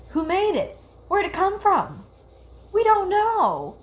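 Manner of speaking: high-pitched, exasperated questioning. Real, not acted. Fan questioning where the evil mist came from, exasperated. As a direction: plead for answers nobody has given.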